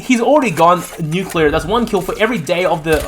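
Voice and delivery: ordinary talk, nothing marked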